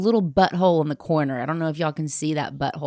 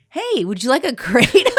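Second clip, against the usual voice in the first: high, loud imitation of an eager offer; hammed-up perky pitch, raised voice; mock friendly offer; 7 semitones higher, 6 dB louder than usual. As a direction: imitate her chirpily pushing a drink. Mimics lady pushing drink on her.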